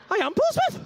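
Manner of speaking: high, loud indignant cry; a sudden high yelp of wounded pride; shocked outburst. Retold or imitated. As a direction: blurt it out, outraged and hurt. Outraged reply to being recognised.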